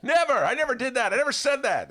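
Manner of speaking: NEVER said high and loud; denying Biden. Retold or imitated. Host mocks Biden denying, stress on never. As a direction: hit never in mock denial. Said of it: "he'll go".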